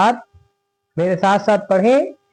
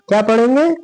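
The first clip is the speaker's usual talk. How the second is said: ordinary delivery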